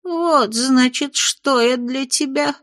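high, hurt imp voice wavering; trembling voice. Acted. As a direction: let the voice quiver, wounded. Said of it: "Голос беса задрожал".

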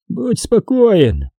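high, slow snappish command; snappish villain. A narrator's reading. Xanatos to his frightened hostage, snappish. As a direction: snap it high, drawing out the words. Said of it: "огрызнулся Ксанатос".

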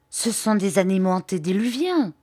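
ordinary delivery

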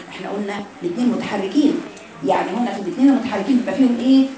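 usual speaking voice

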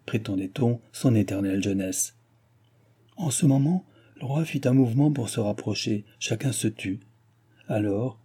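ordinary delivery

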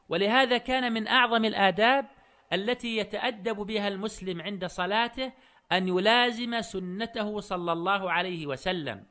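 normal talking voice

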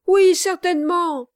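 lively cry, high and loud; eager exclamation. A narrator's reading. Kit calls on the gentleman to confirm his story, eager exclamation. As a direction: cut in with a loud, eager outburst. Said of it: "s'écria vivement Kit".

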